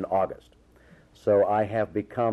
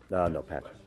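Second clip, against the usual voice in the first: talking while eating; mumbling around a mouthful of food; mouth full; 5 dB quieter than usual. Real, not acted. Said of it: "You can't talk and eat with your mouth full".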